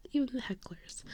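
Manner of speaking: soft, confiding whisper; whispered aside. Real, not acted. Fan repeating it about those who heckled, soft whisper. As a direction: whisper it as an afterthought.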